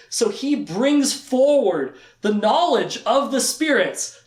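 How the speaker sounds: usual speaking voice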